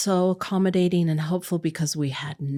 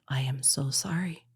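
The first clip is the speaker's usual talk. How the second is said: whispered apology; 4 semitones lower, 6 dB quieter than usual. Retold or imitated. Apologizing to her boss.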